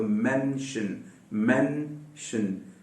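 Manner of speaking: very slow soft मेंशन with a short e; slow model word. Shown as a demonstration. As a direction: stretch out मेंशन gently. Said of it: "मेंशन नहीं है ये".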